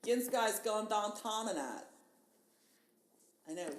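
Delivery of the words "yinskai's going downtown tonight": louder, high Pittsburghese line; Pittsburgh accent. Shown as a demonstration. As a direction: say it like a true Pittsburgh local. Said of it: "with a really authentic Pittsburgh accent".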